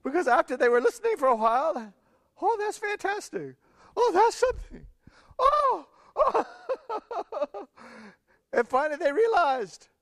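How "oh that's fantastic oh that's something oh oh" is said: high, wondering exclamations; amazed officers. Retold or imitated. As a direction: gasp in awe at what you hear. Officers amazed listening to Jesus.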